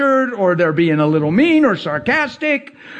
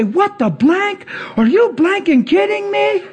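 high, drawn-out mock disbelief; incredulous censored cursing, pitched way up; imitated astonishment; 12 semitones higher, slower than usual. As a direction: play someone stunned by a sudden healing. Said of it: "get healed and they say".

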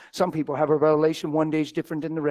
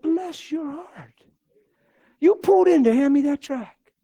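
high, slow, syrupy "bless your heart"; sarcastic sweetness; 10 semitones higher, 5 dB louder, slower than usual. Retold or imitated. Preacher to the tract man, with mock sweetness. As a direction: pour on mock sweetness, drawn out.

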